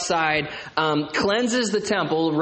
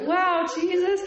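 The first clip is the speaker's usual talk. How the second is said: put-on much higher, wide-eyed kid voice saying "Wow"; awed kids; 14 semitones higher than usual. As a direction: mimic amazed little children. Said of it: "And the kids are like".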